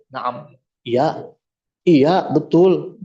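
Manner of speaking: slow, submissive yes-yes; just give in and nod along; meek agreeing. Retold or imitated. Umar meekly answering his scolding wife, slow and resigned.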